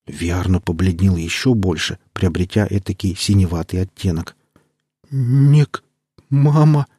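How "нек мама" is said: high, loud frightened stammering; terrified stammer. Acted. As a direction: gasp out the words in sheer terror.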